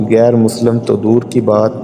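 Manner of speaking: ordinary delivery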